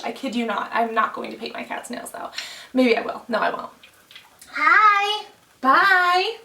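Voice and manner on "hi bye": high, loud sing-song greeting; cute voice to cat. Real, not acted. Calling to the cat.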